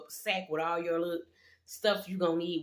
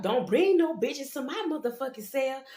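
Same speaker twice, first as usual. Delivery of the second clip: mock scream; 7 semitones higher than usual. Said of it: "the lady gonna scream out".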